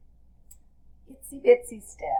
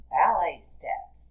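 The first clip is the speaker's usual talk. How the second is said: usual speaking voice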